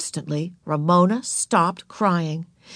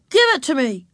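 put-on higher, indignant boy; insistent demand; 6 semitones higher, 3 dB louder than usual. A narrator's reading. Wayne wants his sucker back, demanding.

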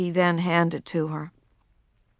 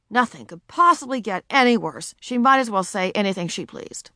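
ordinary delivery, nothing marked

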